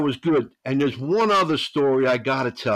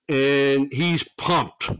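ordinary delivery

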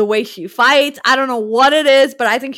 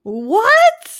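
sharp, much higher disbelieving "what?"; can't believe what he just heard; surprised what; 10 semitones higher, 3 dB louder than usual. Real, not acted. Reacting to his cohost hating the movie, stunned disbelief.

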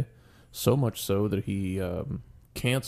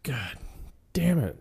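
despairing curse; 5 semitones higher, slower than usual. Retold or imitated. Dropped to knees, lost bet.

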